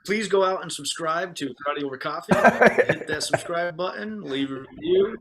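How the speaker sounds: jokey hard sell to the listeners; cheap plug. Pretend. Podcast host begs listeners to subscribe, shameless plug. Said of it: "Cheap plug".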